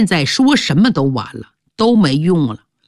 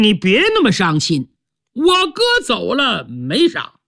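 put-on higher, offhand young man's voice, quick in places; a shallow son brushing off grief; callous younger son; 6 semitones higher than usual. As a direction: younger son telling his father not to mourn, offhand and higher.

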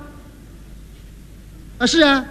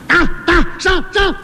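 high shouted charge; yelling attack; 7 semitones higher than usual. Acted. One-eyed bully yelling at his gang to attack, shouting high. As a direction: urge the gang forward at full cry. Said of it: "这小子还一直嚷嚷".

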